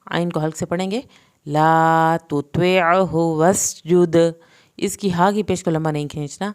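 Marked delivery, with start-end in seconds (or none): 1.5-4.1 very slow, louder chant; slow recitation. Shown as a demonstration. Teacher to students: slow loud tajweed chant. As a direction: intone it with long holds.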